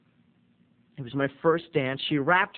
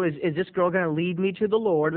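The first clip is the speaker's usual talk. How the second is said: ordinary delivery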